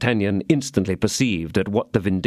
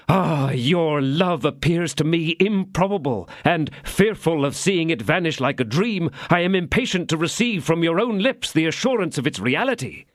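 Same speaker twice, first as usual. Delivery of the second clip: a lightly voiced passionate lover, higher; ardent suitor; 9 semitones higher than usual. D'Artagnan carried away by passion, lightly voiced ardour. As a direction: press her impatiently for her love.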